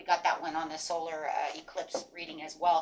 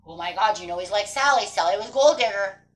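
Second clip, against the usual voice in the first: a fretful inner voice that rises to louder alarm; worried inner voice; 6 dB louder than usual. Retold or imitated. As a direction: play the anxious mind, getting more alarmed. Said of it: "it's gonna say".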